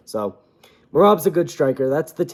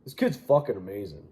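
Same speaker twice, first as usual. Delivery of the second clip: a touch more promoter hype, still lightly voiced; 8 semitones lower, 6 dB quieter than usual. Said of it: "What does he sound like?".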